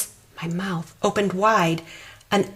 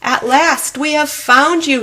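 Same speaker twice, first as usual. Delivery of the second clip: higher, louder beaming welcome; happy monster greeting; 8 semitones higher, 6 dB louder than usual. Acted. Monster joyfully greeting the child, louder and higher. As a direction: greet him like a long-lost friend. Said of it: "he said with a smile".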